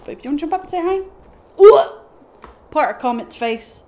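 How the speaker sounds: usual speaking voice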